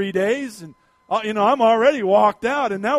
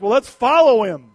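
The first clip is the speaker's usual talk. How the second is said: louder, mock hunters calling out; mock eager call; 8 dB louder than usual. Hunting buddies urging the tired pastor on, mock call. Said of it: "Randall and Tim are like".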